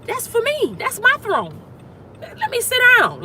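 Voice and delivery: high, amazed voice claiming the throne; delighted discovery. Retold or imitated. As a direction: sound like you just found what's yours. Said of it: "you see the throne and you like".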